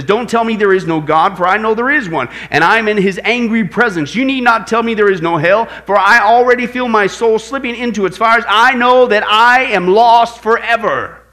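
loud, rising desperate plea, slowing at the end; desperate cry. Retold or imitated. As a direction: cry out in terror of hell. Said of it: "Sir Francis Newport says".

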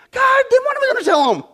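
high-pitched panicky plea; anxious Moses. Retold or imitated. Moses panicking to God about the people's question, high and fast. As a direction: squeal it like a worried man begging. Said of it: "Moses said".

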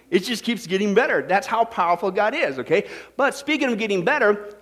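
usual speaking voice

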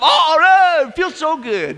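high burst of blissful relief, easing off; acted relief. Preacher imagines a camper's first shower home, acted relief. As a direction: exaggerate the bliss of finally scrubbing clean.

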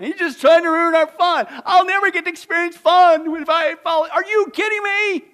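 loud, high fiery sermon; preaching at full force. Acted. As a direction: drive it home to the congregation.

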